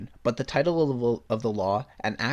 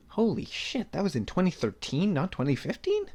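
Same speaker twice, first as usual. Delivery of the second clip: surprised; 8 semitones higher than usual. Real, not acted. Shocked at the date.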